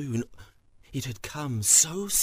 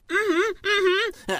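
very high, eager hum; squeaky nervous thief; 8 dB louder than usual. Acted. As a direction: hum agreement in a tiny high voice. Thief squeaks agreement to farmer.

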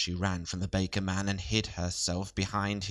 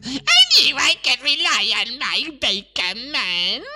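high, loud gloating witch; 10 dB louder than usual. A narrator's reading. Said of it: "said the old witch".

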